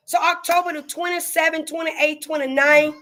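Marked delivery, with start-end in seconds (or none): none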